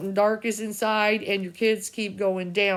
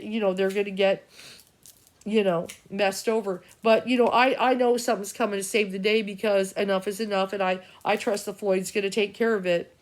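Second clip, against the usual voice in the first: ordinary delivery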